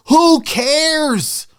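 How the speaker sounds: high, loud who cares; punchy shout; emphatic dismissal. Real, not acted. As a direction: toss the worry away hard. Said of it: "dismissing the fears".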